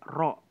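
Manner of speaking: ro said thick and heavy. Shown as a demonstration. Teacher isolating the heavy ro for students. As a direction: sound ro with a full, dark r. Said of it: "tebal banget tuh".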